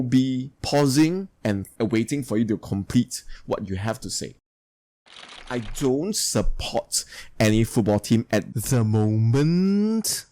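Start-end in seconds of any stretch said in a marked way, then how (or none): 5.5-10.3 slow, with "moment" dipping then rising; fall-rise intonation. Shown as a demonstration. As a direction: draw out "moment", down then up, as if more follows. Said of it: "it goes down and go".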